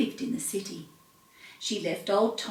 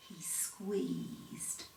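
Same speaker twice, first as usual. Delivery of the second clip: softer hushed whisper; 4 semitones lower, 16 dB quieter than usual. A narrator's reading. Narrator whispering the tense moment of milking. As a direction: breathe it in suspense.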